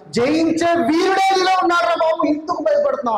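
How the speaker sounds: a father calling out to his frightened son; voicing God the father. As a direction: lift the voice up as the father urging his child. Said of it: "అంటున్నాడు".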